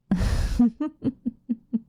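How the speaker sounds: high, rippling run of giggles. Acted. Vampire teasing an impatient listener, high giggle. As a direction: break off into a long, high giggle.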